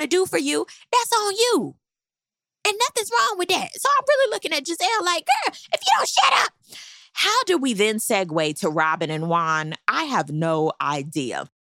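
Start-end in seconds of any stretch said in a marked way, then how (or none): 5.2-6.5 very high-pitched mock scolding; exasperated telling-off. Recapper tells off Gizelle, high exasperated scolding. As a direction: squeal it in playful exasperation.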